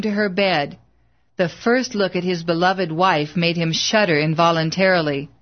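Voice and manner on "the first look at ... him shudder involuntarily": plain reading voice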